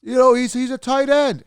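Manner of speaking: high, loud whiny mock voice of a know-it-all fan; mock the nitpicking viewer in a high, loud whine; sneering impression of an annoying commenter; mocking critic. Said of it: "'cause they'll say".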